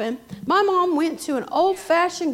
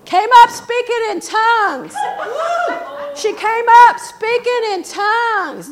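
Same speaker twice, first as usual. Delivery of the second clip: loud, high preacher's cry; impassioned preaching; 8 semitones higher, 7 dB louder than usual. Acted. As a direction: preach it at full force, stretching the key words. Preaches at full force.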